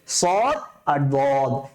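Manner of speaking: "সদ" and "বদ" thick and slow, rounded o; thick heavy letters. Shown as a demonstration. Teacher summing up two letters, thick slow "সদ আর বদ". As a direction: draw out "সদ" and "বদ" with a heavy mouth. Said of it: "দুইটাই মোটা করে উচ্চারণ করতে হবে".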